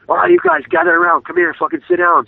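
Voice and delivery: high mock yell calling the team in; hammed-up furious coach rounding everyone up; imitating angry coach. Coach storms into gym and calls the team together angrily. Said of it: "come storming in the gym, super pissed off".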